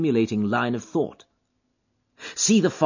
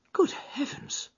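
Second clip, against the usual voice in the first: whispered, higher gasp of shock; amazement; 5 dB quieter, slower than usual. Acted. Holmes hearing of the death, amazed. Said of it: "cried Holmes in amazement".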